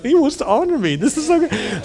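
high, thrilled self-congratulation; smug Haman, privately delighted; arrogant glee. Acted. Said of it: "Haman is so arrogant".

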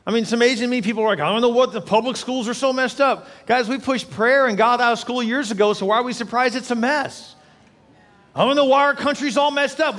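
high, forceful, driving exhortation; impassioned preaching. Real, not acted. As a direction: preach at full force, pleading for the nation.

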